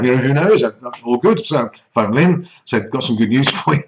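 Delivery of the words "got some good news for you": breaking into a laugh mid-line. Real, not acted. Husband phones Lynn with news, cracking up laughing. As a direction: try to say it, cracking up.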